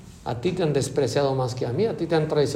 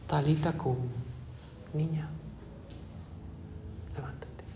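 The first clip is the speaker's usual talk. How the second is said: much softer, tender call to wake; gentle hush; 2 semitones lower, 14 dB quieter than usual. Acted. Jesus waking Jairo's daughter, tender soft call. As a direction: coax the girl up softly, almost breathed.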